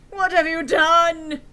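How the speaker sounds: mock-tragic slow cry; crying wisely. A narrator's reading. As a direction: grieve it out slowly and loudly. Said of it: "He started to cry wisely".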